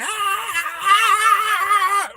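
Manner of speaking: long, high, loud mock scream; acted shriek. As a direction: scream like the queen under fire. Acts out queen pinned by minigun.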